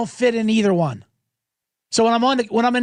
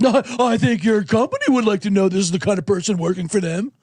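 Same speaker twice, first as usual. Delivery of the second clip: prim, self-righteous complaint voice; a busybody emailing the company, smug and prim; snide internet tattler. Retold or imitated. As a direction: tattle to the boss with fake concern. Voicing an internet harasser.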